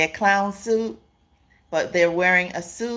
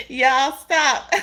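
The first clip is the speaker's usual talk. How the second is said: laughing; 7 semitones higher than usual. Real, not acted. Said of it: "don't make me laugh on here".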